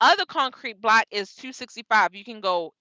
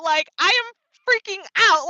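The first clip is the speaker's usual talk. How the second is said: pitched up, more worked up; freaking out, higher; 7 semitones higher, 3 dB louder than usual. Real, not acted. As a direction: push the panic higher on the repeat.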